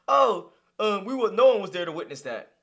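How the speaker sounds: a mock snooty imitation of the guest. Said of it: "Basically calling you a liar".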